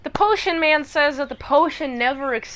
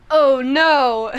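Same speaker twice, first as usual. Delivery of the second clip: loud mock-dismayed oh no; acted horror at a dropped drink; dismayed cry; 6 dB louder than usual. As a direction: wail it out, hammed-up. Man sees the antidote dropped.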